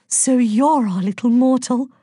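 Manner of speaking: almost sing the greeting; honeyed, musical lilt; singsong. A narrator's reading. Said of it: "sang Aphrodite".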